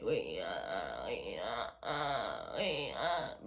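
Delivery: low, breathy whisper of "wake me up"; barely voiced, pleading under the breath; whispered plea. Reenacting her sleep-paralysis plea to mom, whispered. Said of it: "So this is me, like".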